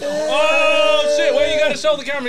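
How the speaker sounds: loud, high, excited call; excited shout. Real, not acted. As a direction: call it out eagerly.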